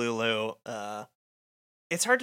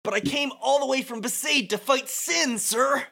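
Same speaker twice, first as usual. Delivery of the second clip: much higher, earnest cadet voice; high pleading cadet; 14 semitones higher than usual. Acted. Young crusader begging his officer, high and earnest. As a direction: plead like a young eager soldier.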